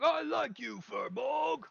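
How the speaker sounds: high, loud, strained ogre cheer; loud high creature voice, bursting with friendliness; affectionate ogre. Acted. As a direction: gush at your new friend as an ogre. Ogre bear-hugs his rival.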